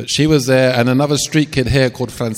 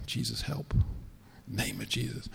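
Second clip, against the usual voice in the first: hushed prayer; 15 dB quieter than usual. Retold or imitated. Praying quietly in fear.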